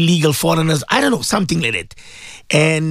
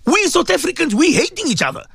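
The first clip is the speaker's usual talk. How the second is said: rising, impassioned appeal; passionate outcry; 9 semitones higher than usual. Real, not acted. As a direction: preach it like a warning to the nation.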